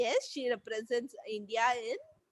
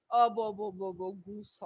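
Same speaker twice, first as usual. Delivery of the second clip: talking with mouth full; 5 semitones lower than usual. Shown as a demonstration. Child at the dinner table asking with mouth full of food, muffled mumble. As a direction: garble it like your cheeks are stuffed. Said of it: "a mouth full of food and you ask for something".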